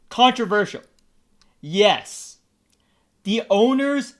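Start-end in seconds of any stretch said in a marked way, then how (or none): none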